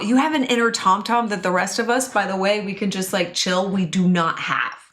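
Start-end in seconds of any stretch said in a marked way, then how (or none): none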